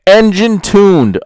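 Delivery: slow, booming mock announcement; mocking shout. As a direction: belt out the ad's boast sarcastically. Mocking a repeated line in ad.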